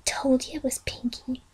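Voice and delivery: breathy aside, barely voiced; whisper. Twilight nudges Fluttershy and whispers, hushed. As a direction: whisper it to your friend. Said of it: "nudge to Fluttershy and whispered".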